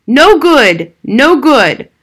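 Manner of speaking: full-throated shout, high and loud; screaming. A narrator's reading. Father refusing his son, screaming at full strength. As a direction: scream it with all his might. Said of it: "screamed with all his might".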